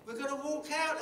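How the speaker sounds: the cry of the walkout, repeated with joy; triumphant repeat. Retold or imitated. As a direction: repeat the call like a victory. Repeating the rallying call.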